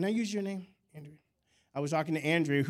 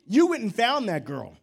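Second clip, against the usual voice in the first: loud, raised-pitch correction; emphatic preacherly scolding; retold rebuke; 5 semitones higher, 7 dB louder than usual. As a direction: drive the rebuke home, louder and higher.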